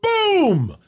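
high, sudden boom for the crash; crash sound. Retold or imitated. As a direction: blurt out the impact. Describing the car crash.

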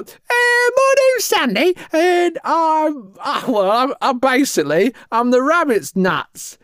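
very high, squealy self-introduction; cartoonish, self-important guest voice; Sandy the influencer. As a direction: pitch way up and show off. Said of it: "if she wanted to talk to a magazine".

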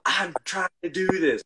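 retold frustrated outburst, lightly acted; exasperated player. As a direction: imitate a player protesting to the coach. Acted court argument with coach.

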